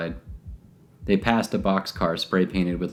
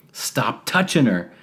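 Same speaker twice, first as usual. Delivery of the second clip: yell; 5 semitones higher, slower than usual. A narrator's reading. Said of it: "Wesley yelled".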